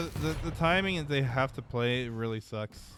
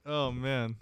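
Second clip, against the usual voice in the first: usual speaking voice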